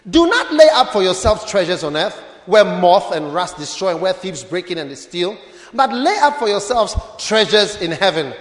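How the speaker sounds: scripture reading. Acted. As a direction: proclaim the verse with weight. Pastor reads scripture aloud.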